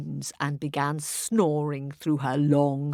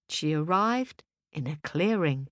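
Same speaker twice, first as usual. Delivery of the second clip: ordinary delivery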